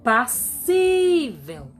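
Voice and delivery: "passível" slowed, with SÍ held long and loud; stressed syllable exaggerated. Shown as a demonstration. Teacher showing how to find the tonic syllable, SÍ in "passível" drawn out. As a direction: stretch the SÍ in "passível" forcefully. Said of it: "é só encontrar a sílaba tônica".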